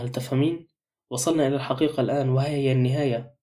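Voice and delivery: plain reading voice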